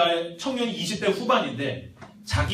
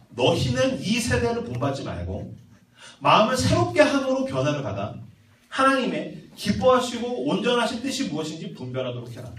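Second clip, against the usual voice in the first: raised, emphatic preaching voice; forceful verse reading, pitched up; scripture proclamation; 4 semitones higher than usual. Real, not acted. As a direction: declare the verse to the congregation.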